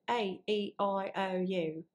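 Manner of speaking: closed British vowels; proper British accent. Shown as a demonstration. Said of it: "proper British accent, they close it a little bit".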